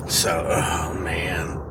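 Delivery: very low, slow near-whisper; resigned sigh. Real, not acted. Driver stuck in traffic before work, real resigned sigh. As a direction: let it out softly and heavily.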